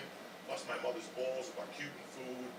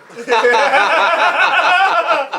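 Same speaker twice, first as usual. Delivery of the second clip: high, loud howling laugh; loud cackling laugh; 13 semitones higher, 25 dB louder than usual. Real, not acted. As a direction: burst into a howl. Laughing fit.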